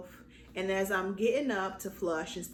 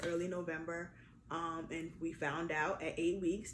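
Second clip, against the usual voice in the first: ordinary talk, nothing marked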